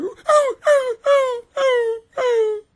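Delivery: high puppy whimper. Retold or imitated. Mimicking a trained puppy, high whimpering. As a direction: whine like a beaten puppy. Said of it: "You're like".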